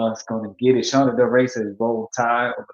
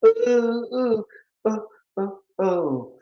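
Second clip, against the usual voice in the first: high oohs stepping down and fading; playful oohs; 9 semitones higher, slower than usual. Pretend. Vocal riff after the jingle.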